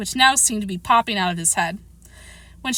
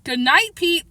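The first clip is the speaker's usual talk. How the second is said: raised, firm good night; great finality; 9 semitones higher, 3 dB louder, slower than usual. A narrator's reading. Mother sending her son to bed, with great finality. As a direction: shut the matter down, higher pitched. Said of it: "said with great finality".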